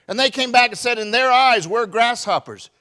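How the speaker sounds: fiery preacher shout; full-force preaching. Acted. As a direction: thunder it out to the congregation.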